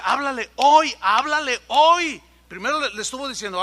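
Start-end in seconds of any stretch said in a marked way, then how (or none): 0.0-0.9 loud urgent command, háblale hoy pressed hard; commanding call. Retold or imitated. The Spirit urging the boy to speak, loud command. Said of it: "el Espíritu me decía". 1.0-2.2 loud and slow, háblale hoy dragged out with a falling hoy; slow insistent command. Retold or imitated. The Spirit insisting again to the boy, slow loud command. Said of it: "el Espíritu me decía".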